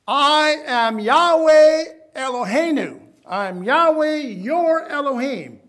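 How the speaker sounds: slow, loud proclamation as God; thundering voice of God; booming divine voice. Acted. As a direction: declare it like the Almighty. Preacher voicing God's declaration.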